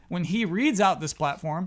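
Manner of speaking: normal talking voice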